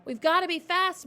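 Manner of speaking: high loud urgent cry; a child insisting. A narrator's reading. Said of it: "Matilda cried".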